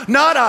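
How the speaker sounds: not hit loud and high; punched not. Acted. Preacher knocking down excuses, punching not.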